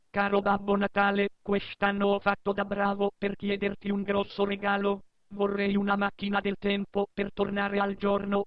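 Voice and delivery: put-on high squeaky kid voice; child voice. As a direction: read it like a small child. Second child's letter.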